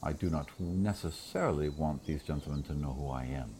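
low, very soft, regal murmur; quiet, low, measured confidence; hushed in-character reply. Acted. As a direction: answer privately as a queen, keeping it down. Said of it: "like, whisper to Cyrena real quick".

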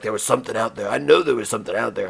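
a rough attempt at an action hero; Jason Statham. Rescue diver insisting on what he saw, lightly imitated. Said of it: "not a good impression of him".

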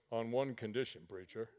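low, soft warning; quiet menace; big man's low threat. Retold or imitated. Big man warning the preacher, low and soft. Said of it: "he looks at me and goes".

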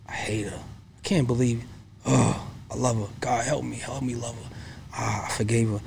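soft, hushed self-talk, half whispered; whispering to himself about his wife; whispered inner turmoil. Retold or imitated. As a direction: mutter a desperate prayer under your breath. Inner struggle, retold private thoughts.

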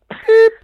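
very high, loud bleep in place of a swear; mock censor beep. Host jokingly bleeping out the swear word, mock censor beep. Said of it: "in certain language".

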